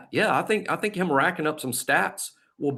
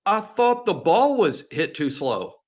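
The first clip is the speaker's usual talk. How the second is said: higher, slow answer back to coach; retold excuse, pitched up and drawn out; young player's reply; 6 semitones higher, slower than usual. Young player explaining himself to the head coach, higher and slow. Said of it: "I said".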